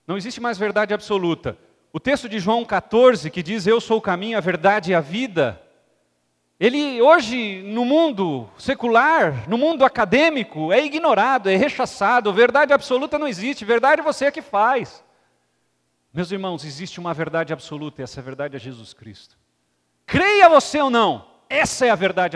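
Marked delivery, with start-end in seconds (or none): 1.9-15.0 preaching at full force. Acted. Preacher to congregation, full-force preaching. 20.1-22.4 loud emphatic challenge; preaching shout. Acted. Preacher to congregation, loud emphatic shout.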